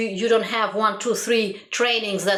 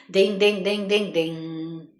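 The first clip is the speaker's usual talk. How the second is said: chanted ding-ding-ding, trailing off slower; imitated ringing; slower than usual. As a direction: imite une sonnerie qui ralentit. Imitates a money-making machine.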